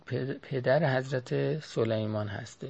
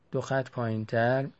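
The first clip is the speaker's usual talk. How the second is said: ordinary talk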